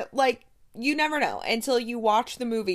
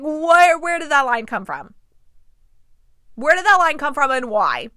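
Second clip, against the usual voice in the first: loud, high baffled question; incredulous; 4 semitones higher, 6 dB louder than usual. Retold or imitated. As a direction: demand it in disbelief. Baffled by a line.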